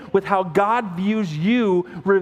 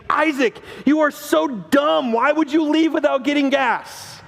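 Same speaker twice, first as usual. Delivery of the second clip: higher, harsher mock rebuke; mock scolding; 6 semitones higher than usual. Shown as a demonstration. A father demonstrating how not to scold his son, higher and harsh. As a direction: demo of a hurtful put-down, pitched up. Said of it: "There is a difference in me saying".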